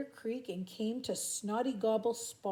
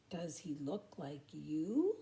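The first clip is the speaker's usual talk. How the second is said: slow, lower, sleepy drawl; 6 semitones lower, 4 dB quieter, slower than usual. Acted. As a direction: ask it slowly, half asleep. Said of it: "she asked slowly".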